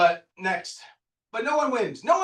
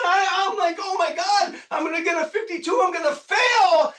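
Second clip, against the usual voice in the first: high, loud panicked rant; frantic full-voiced yelling; 9 semitones higher, 6 dB louder than usual. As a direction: yell it out, worked up and much higher. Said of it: "I'm yelling".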